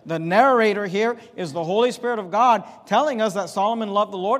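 ordinary delivery

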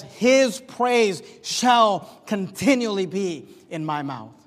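slow, deliberate stress on "His praise shall continually"; emphasis on his praise. Shown as a demonstration. Preacher reading the psalm to his church, slow and emphatic. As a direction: savour every word of "continually". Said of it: "Notice the emphasis here".

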